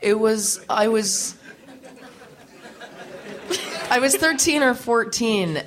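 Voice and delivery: laughing through the words, high and loud; bursting into laughter mid-sentence. Real, not acted. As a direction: giggle as the memory comes back. Laughing while starting her story.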